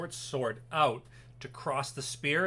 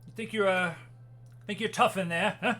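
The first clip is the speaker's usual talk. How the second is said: higher-pitched guard's taunt with a laugh in it; 7 semitones higher, 3 dB louder than usual. Acted. Guard taunts the prisoner through the bars, mocking. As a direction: needle the prisoner, a little louder.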